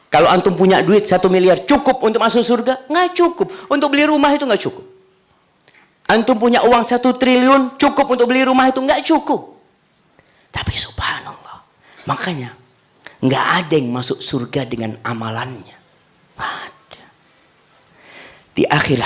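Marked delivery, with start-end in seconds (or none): 0.1-9.5 high, loud fervent preaching. Acted. Preacher pressing the congregation, high and loud. 16.3-17.0 breathy final whisper. Acted. Preacher whispering the conclusion to the congregation.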